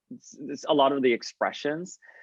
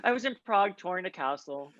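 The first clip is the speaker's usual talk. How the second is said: higher, quick teasing echo; mock pretentious; 6 semitones higher, faster than usual. Teasing a friend's fancy travel story, mock pretentious. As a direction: mimic a friend showing off. Mocks his pretentious remark.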